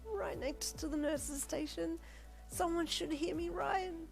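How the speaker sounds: high, slow teary voice; weepy mumble; tearful. Acted. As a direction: talk yourself through tears. Tearful, in pain.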